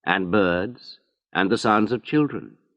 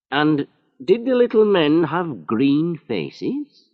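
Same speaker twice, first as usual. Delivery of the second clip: slow, higher, teasing question; mocking; 9 semitones higher, 4 dB louder, slower than usual. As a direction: gently ridicule the story. Inspector mocks spaceship story.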